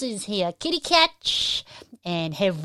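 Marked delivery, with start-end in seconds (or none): 0.5-1.6 high, louder, stretched-out name; excited shout-out. Real, not acted. Host welcoming a patron to the stream, excited shout-out. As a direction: sing out the name with delight. Host calls out viewer names.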